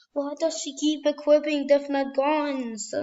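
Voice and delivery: ordinary talk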